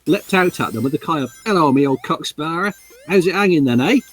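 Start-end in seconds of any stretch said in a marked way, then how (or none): 1.4-4.0 Cockney. Acted. Monkey character in Cockney voice.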